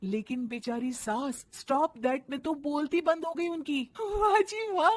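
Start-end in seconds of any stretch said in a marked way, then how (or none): 4.0-4.9 gloating. Acted. Bride gloating.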